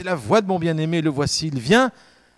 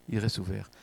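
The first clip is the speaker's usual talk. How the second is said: softly repeated; 5 semitones lower, 9 dB quieter than usual. Acted. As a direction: drop to a hushed, lower repeat. Preacher repeats key phrase.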